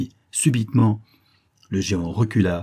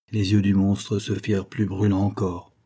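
ordinary delivery

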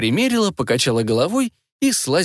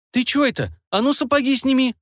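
put-on much higher, a sharp older-sister order; bossy command; 12 semitones higher than usual. A narrator's reading. Vaska orders the boy to take off his boots, sharp and bossy. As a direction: order him off the bed like a kid brother. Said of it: "привычно скомандовала Васька".

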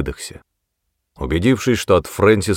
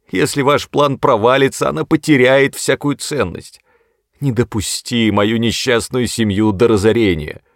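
higher, louder desperate begging; wailing plea; 5 semitones higher, 4 dB louder than usual. A narrator's reading. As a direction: plead as if all is lost. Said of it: "запричитал". (причитая, высоким голосом)

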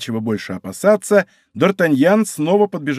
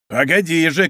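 ordinary delivery, nothing marked

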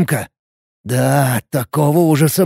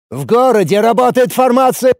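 raised, high shouting; орать в ответ; 9 semitones higher, 4 dB louder than usual. A narrator's reading. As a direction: shout the argument back at him. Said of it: "орал в ответ Ацеф". (громко, на повышенных тонах)

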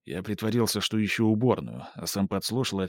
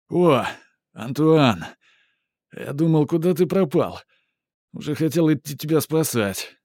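high, bright tipsy hello; cheerful greeting; 6 semitones higher, 4 dB louder than usual. Acted. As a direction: call out gladly, pitched up. Tipsy artist greets friend.

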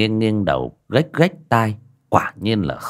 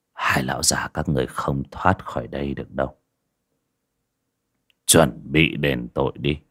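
low, softer, menacing verdict; cold, chilling voice; 6 semitones lower, 4 dB quieter than usual. A narrator's reading. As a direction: pass sentence coldly, low and quiet. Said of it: "mới cất giọng lạnh lẽo".

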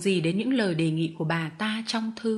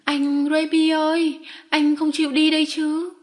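loud tearful cry; 7 semitones higher, 5 dB louder than usual. A narrator's reading. Crying Arthur to Remy who is leaving, loud pleading cry. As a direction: call out pleadingly like an upset little boy. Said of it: "Nó kêu to".

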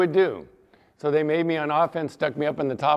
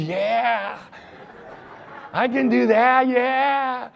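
put-on higher, eager child voice; boy agreeing to help, childlike and eager; little boy; 7 semitones higher, much slower than usual. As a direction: play a keen little kid.

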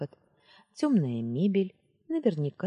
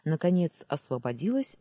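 usual speaking voice

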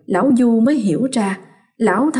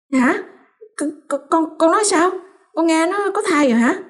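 stammering, much higher, shocked; 9 semitones higher, faster than usual. Acted. Mother-in-law hearing of the pregnancy, shocked stammer. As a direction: ask it in a stunned rush. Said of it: "hỏi gấp gáp".

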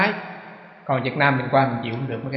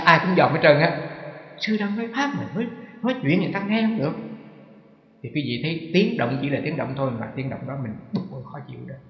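normal talking voice

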